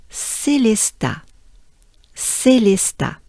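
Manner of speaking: Célestat repeated carefully in French; teacher's clear French Célestat; pronunciation model. Shown as a demonstration. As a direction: model Célestat twice for learners. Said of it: "Let's break these all down".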